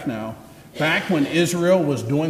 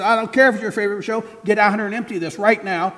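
angry mother; 7 semitones higher than usual. Acted. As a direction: cut her off and insist. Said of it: "And she says".

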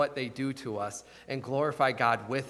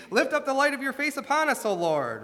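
lifted quote; 10 semitones higher, 3 dB louder than usual. Retold or imitated. Quoting the psalm line.

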